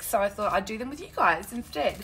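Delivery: normal talking voice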